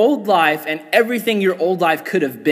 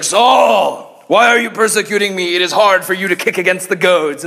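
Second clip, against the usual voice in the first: booming God; 2 semitones higher, 4 dB louder than usual. Acted. God rebuking Saul from above, raised booming voice. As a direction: proclaim it like a mighty God. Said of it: "God shows up and he's like".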